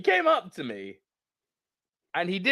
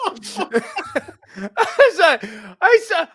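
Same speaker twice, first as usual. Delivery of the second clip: ordinary talk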